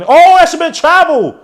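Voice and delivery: high, loud protest at the ref; outraged shout. Real, not acted. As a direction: burst out yelling in protest. Protesting a missed travel call.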